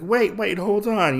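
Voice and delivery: high, startled plea to stop; lightly acted scramble to halt someone; urgent mock protest. Usagi stopping the mysterious woman leaving, urgent mock protest.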